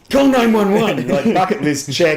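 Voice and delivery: high, loud whoop through laughter; playacted emergency yell; mock excited shout. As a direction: shout it like a bucket-list thrill. Acting out emergency call, thrilled.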